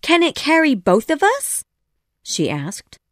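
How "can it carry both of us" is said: put-on much higher girl character voice; Wendy. A narrator's reading. Wendy answers Peter on the rock, high girl voice. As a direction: pitch it high for the girl's part.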